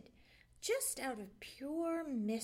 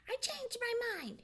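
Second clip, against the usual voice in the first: higher, curt snap; snapped; 12 semitones higher than usual. A narrator's reading. Fox snapping curtly at the jay. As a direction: bite the words off. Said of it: "he snapped".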